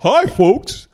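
high, loud cartoon mascot greeting; Family Vacation moose. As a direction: put on a goofy, high-pitched mascot voice. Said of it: "the moose from Family Vacation".

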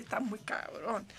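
ordinary delivery

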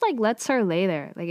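usual speaking voice

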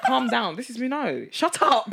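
high, loud mock outburst, shut him down; mock angry guy. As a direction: retell the guy's loud, high-pitched snap like a comic outburst. Man retold snapping at her.

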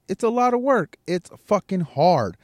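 high loud building push. Real, not acted. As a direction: climb up and hit hard. Rising shout in rant.

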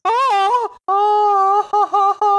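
very high, loud mock hysterical cackle; insane villain laugh. The villain after his master stabs him, very high hysterical cackle. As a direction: shriek a manic laugh. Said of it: "He's like".

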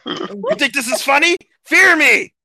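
furious booming threat; menacing shout. Acted. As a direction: roar it to scare them.

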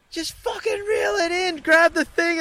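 high-pitched, frantic retold yell. Yelling at his wife to reel the line in, frantic. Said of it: "Don't yell at me".

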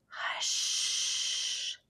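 put-on soft breathy old lady hush; old woman whispering. A narrator's reading. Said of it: "to the old lady, whispering".

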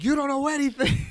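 high, loud mock snap at the family; hammed-up shrill outburst; snapping yell. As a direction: shout it like a teen throwing a tantrum. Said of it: "I was like".

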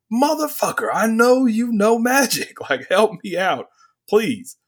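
high, exasperated pleading; exasperated shout. Retold or imitated. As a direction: yell it at her, fed up. Quoting furious warrior.

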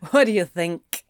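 mildly scornful snort of a question; snorting retort. A narrator's reading. Demon scoffs at his counterpart's question, snorted retort. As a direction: toss it back with a light snort. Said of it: "Anthony snorted".

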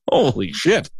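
normal talking voice

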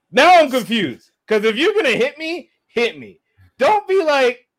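loud, high-pitched protest; worked-up, incredulous complaint; exasperated outburst. Retold or imitated. Exasperated outburst at officer's mixed signals.

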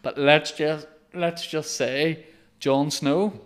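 teasing influencer. Retold or imitated. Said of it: "do an Instagram live of".

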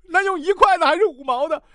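high, anxious put-on questioning; indecisive patient. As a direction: press the doctor with a high, needy question. Indecisive patient voiced against doctor.

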